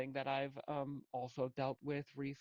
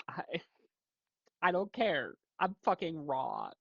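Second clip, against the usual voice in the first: louder and higher, raw and defiant; defiant outburst; 4 semitones higher, 5 dB louder than usual. Real, not acted. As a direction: throw it out, refusing to care anymore. Emotional outburst on camera.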